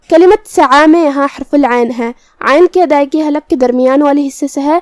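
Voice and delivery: ordinary talk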